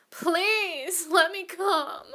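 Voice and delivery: high, loud pleading wail; desperate cry. Acted. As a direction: cry out and beg, drawn out and high. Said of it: "cried out".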